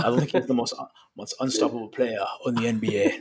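put-on a hesitant, half-hearted try at a Cameroonian accent; tentative Cameroonian accent. Cohost asks him for Embiid's line, tentative Cameroonian accent. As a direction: softly test a Cameroonian accent, giving up halfway. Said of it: "can you say that in a Cameroon accent".

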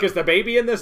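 high loud squeak of a question; mock eager question. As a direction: ask it like an overexcited fan. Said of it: "You're like".

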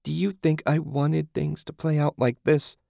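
high, insistent pleading question. A narrator's reading. Julie appealing to Susan, pleading in a high voice. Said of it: "Julie pleaded".

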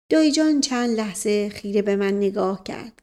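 ordinary delivery, nothing marked

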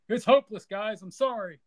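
high, loud, despairing hero; despairing pilot voice. Retold or imitated. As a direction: play it hopeless and defeated. Poe gives up in a story.

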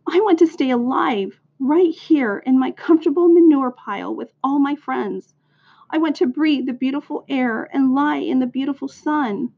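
high, plaintive little-pig lament; sustained high wail, acted by a storyteller; tearful moaning. A narrator's reading. Pig in tears wanting to live with friends, moaned. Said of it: "Wilbur burst into tears".

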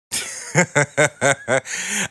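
high, loud cackle; big unhinged laugh; villain cackle. Acted. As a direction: cackle wildly at full volume. Villain rant climax.

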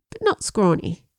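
plain reading voice

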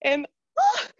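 high, loud excited gushing; can't contain the excitement. Real, not acted. Gushing about sequel.

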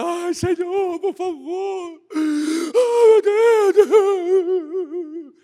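high, whimpering, pleading mock prayer; desperate prayer. Panicked believer begs God, high whimpering plea. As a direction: beg like a panicked believer, near crying. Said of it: "nossa oração não é desesperada".

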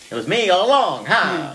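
hammed-up old movie mobster; old-time gangster voice. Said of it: "he does it in that voice".

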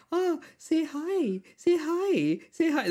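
high, slow coaxing auntie voice. Retold or imitated. Auntie urging her child to greet the old man, high and slow. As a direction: coax the shy kid to say hi. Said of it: "the auntie's just, like".

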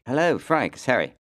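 high, loud mock British Prince Harry voice; a hammed-up posh English royal on the phone; British accent impression. Frank doing Harry calling him, British accent. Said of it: "my accent falls apart".

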